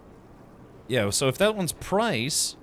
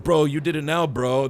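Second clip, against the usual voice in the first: mock shouting bro; 4 semitones higher, 6 dB louder than usual. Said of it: "they were just shouting "bro".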